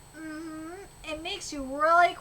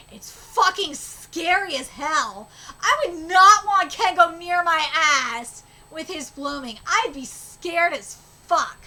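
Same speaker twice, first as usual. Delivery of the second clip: excited rave; 9 dB louder, slower than usual. Real, not acted. Reader raves about the fight.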